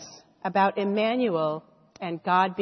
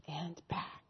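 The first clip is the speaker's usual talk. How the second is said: breathy whispered ending; 4 semitones lower, 16 dB quieter than usual. Acted. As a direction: whisper it like a goodnight secret. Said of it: "whispered with a smile".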